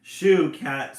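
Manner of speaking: very loud shooing scream; mother bird screams at the cat. A narrator's reading. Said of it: "screams Mama Jay".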